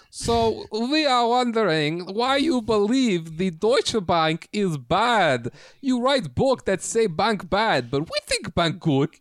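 high, slow, hammed-up bad German accent; a mock German interviewer in broken English. Podcast hosts mock-interviewing an author about Deutsche Bank, hammed-up German accent. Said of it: "We should have all done German accents".

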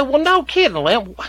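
high, loud sarcastic retort; sarcastic exasperation. Retold or imitated. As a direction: bite back, exasperated and high.

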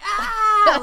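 high loud retold scream. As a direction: scream it out like a fright. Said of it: "like, screams".

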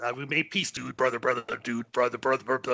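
lightly voiced wrestler, piling up catchwords; Hulk Hogan. Co-host spoofs wrestler saying they made peace, light impression. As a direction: run the words together like a parody wrestler. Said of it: "mysterious meeting with Hogan".

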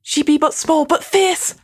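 high, proud declamation; proud character voice. Acted. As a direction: proclaim it like an old saying. Quoting in a character voice.

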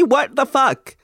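ordinary talk

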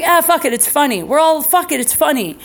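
imitating a friend who just doesn't care; carefree fuck it. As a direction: toss it off with glee. Tiffany deciding to post anyway.

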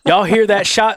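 lightly put-on Alabama accent; Southern twang. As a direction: read it with some Southern twang. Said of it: "do it with an accent".

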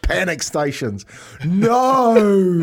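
sudden loud panicked yell. Retold or imitated. Retelling waking late, a loud panicked shout. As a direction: jolt awake in a panic and yell it. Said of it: "I was doing the, "No!".

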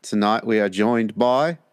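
slow, careful imitation of an English host; mimicked English accent. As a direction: parrot the English accent slowly. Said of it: "That's quite good".

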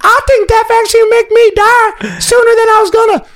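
very high, put-on squawky voice; mock interviewee in a loud-ish falsetto; Susie. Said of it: "Susie, what do you have to say".

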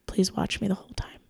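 hushed, urgent aside; soft retold whisper for help; whispered plea. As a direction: whisper it secretly to an ally. Secretly asking bouncer for protection.

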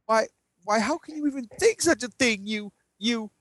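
high, indignant gasping protest; hammed-up outraged innocence; mock shocked hero. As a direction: sputter like a scandalized goody-goody. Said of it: "Mnemonic Lass is like".

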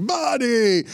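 high, drawn-out greeting; cartoonish father impression. Father hailing Steven Jackson, cartoonishly high. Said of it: "And so my father's like".

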